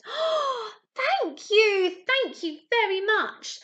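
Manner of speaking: high, loud, gushing little fish; a small fish thrilled with its gift, high and loud; bubbly thanks. Acted. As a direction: squeal your thanks like a delighted little fish. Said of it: "the little fish bubbled playfully".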